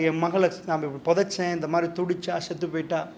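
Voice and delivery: low, very soft retelling of a grieving father; retold weeping confession. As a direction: quietly voice his confession, sinking away. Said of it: "அந்த நேரம் அழாதவர் இப்ப அழுகிறார்". (துயரத்தை மெல்லிய குரலில் சொல்லுதல்)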